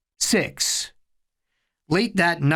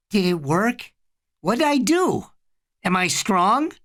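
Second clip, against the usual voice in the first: put-on excited child voice; 6 semitones higher than usual. Eddie waking, eager.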